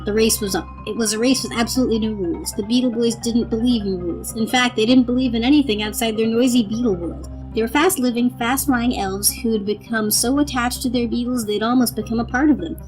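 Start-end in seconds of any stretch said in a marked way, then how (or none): none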